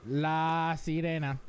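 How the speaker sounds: slow, drawn-out Spanish "La Sirena"; careful demo of Spanish "La Sirena"; Spanish pronunciation. Shown as a demonstration. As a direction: stretch "La Sirena" with Spanish vowels. Models the Spanish name slowly.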